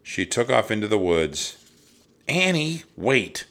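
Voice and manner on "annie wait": raised, higher calling voice. A narrator's reading. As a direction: call after someone running off. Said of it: "Jack called".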